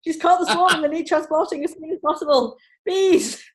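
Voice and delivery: high, loud frantic text reading. Retold or imitated. Texting her husband mid-speech, frantic and high. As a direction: read the messages like you're in a panic. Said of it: "in sheer panic".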